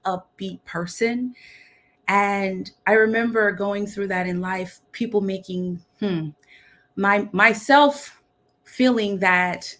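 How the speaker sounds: ordinary talk, nothing marked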